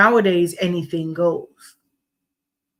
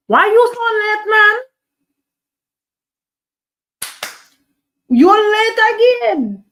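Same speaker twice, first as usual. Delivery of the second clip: high-pitched nagging voice; squeaky scold; high mock scolding; 14 semitones higher, 9 dB louder than usual.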